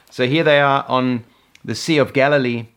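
usual speaking voice

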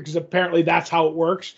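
usual speaking voice

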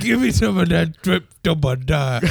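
slurred, playful Creole; mock Creole accent. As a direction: garble it like a silly Creole diner. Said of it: "dumbest accent is definitely Creole accent".

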